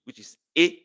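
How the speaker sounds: which is A, the vowel A said high and clear. Shown as a demonstration. Teacher demonstrating vowel A. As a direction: stress the A in "which is A" as a distinct vowel. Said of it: "It is E".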